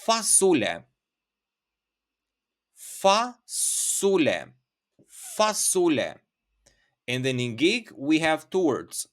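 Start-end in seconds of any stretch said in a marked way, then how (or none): 0.1-6.1 fasule, drawn out slowly and set apart; slow word demo. Shown as a demonstration. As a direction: stretch fasule so learners catch each syllable. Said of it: "you can say".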